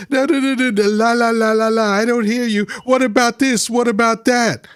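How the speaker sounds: high, sing-song mock of a child covering its ears; la la la like a kid who won't listen; mocking childish voice. Mocking MAGA chatters as kids refusing to listen, high sing-song. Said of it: "you're like".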